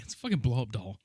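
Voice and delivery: ordinary talk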